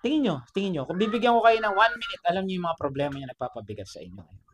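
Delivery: normal talking voice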